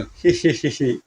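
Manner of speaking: soft run of hee-hees; sneaky giggle. Acted. Havaldar pushing his head into the wall, sneaky giggle.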